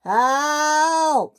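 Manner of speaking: high, drawn-out call for help. Acted. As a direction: call out across the yard for help. James stranded, calling for help.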